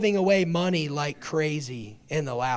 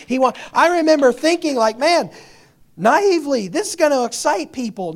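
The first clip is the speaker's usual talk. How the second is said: preaching with rising fire, louder and higher; fiery preaching; 6 semitones higher, 9 dB louder than usual. Real, not acted. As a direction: build the sermon up with passion.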